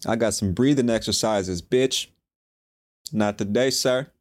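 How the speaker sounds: defiant retort to his own panic; defiant to his brain. Retold or imitated. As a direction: tell the panic off with swagger. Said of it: "I was like".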